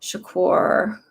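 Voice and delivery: ordinary delivery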